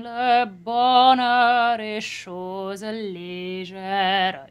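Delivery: slow sung légère leaning on the syllable before the stress; stressed unstressed syllable. Shown as a demonstration. Coach demonstrating French stress to singer. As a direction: sing légère nudging its first syllable. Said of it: "if you stress a little bit of légère".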